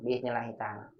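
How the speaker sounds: normal talking voice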